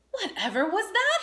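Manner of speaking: high, loud startled question; jolted from his tea; startled cry. A narrator's reading. Badger hears a thud outside, high startled cry. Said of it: "he cried".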